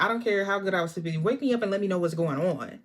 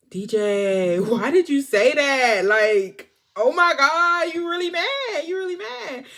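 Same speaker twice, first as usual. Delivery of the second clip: mock teasing; 5 semitones higher, 6 dB louder, slower than usual. Retold teasing of upset D J.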